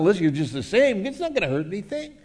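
high, quick coaxing; wheedling tempter. Acted. The flesh coaxing a believer back, high wheedling. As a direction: talk them into it.

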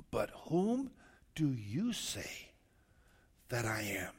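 slow, dropping to quiet on do you say; slow pointed question. Acted. Jesus asking the twelve who they say he is, slow and pointed. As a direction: lean into it, probing.

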